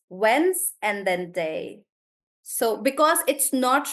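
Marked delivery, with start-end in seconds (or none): 0.1-1.8 "wens and then day" said slowly, parts held apart; slow syllable split. Shown as a demonstration. Teacher breaking Wednesday into two syllables for the class. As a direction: lay out the two halves one at a time. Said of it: "It's two syllables".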